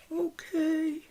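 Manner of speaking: put-on high quavery old lady "Okay"; little old lady voice. Shown as a demonstration. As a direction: squeak it like a sweet old lady. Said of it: "That's my little old lady voice".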